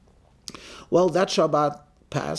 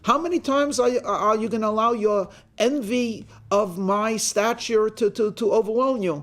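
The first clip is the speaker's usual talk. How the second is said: indignant, higher-pitched mock outrage; acted irate; 6 semitones higher than usual. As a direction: protest like an offended gentleman. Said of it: "seems very irate and says".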